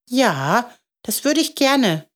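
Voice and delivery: put-on high child's voice; eager little boy. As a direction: accept gladly in a small boy's pitch. Boy eagerly accepts the offer.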